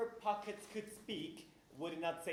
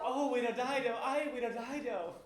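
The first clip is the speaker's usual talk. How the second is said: louder, higher, laughing jeer; loud mocking echo; 5 semitones higher, 5 dB louder than usual. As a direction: crow the phrase back at him. Echoing to mock Gonzalo.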